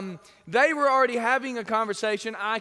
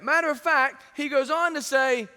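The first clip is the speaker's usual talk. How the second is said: ordinary talk, nothing marked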